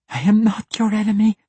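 high, loud desperate denial; rasped protest. A narrator's reading. Captive at dagger point denies being his enemy, rasped protest. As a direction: rasp it out high and loud, pleading. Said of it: "she rasped".

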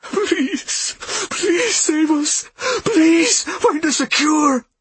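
high, slow sobbing plea. A narrator's reading. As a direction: beg for a cure through sobs. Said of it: "his chest lurched with heavy sobs".

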